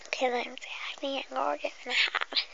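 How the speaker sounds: soft unvoiced whisper to the viewer. Whispering to the viewer before the pretend hairdresser game. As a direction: breathe every word like an ASMR intro.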